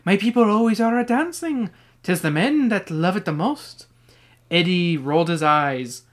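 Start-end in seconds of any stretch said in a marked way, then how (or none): 0.0-3.9 lilting stage Irish storyteller; put-on Irish accent. Mister O'Grady telling of his people, Irish accent. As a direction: lift the pitch into a playful Irish accent. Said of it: "I like your Irish accent there".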